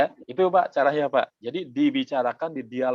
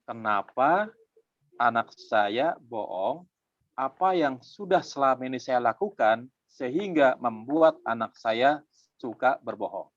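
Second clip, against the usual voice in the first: slow reflective question; slower than usual. Shown as a demonstration. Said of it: "Apa pertanyaannya?".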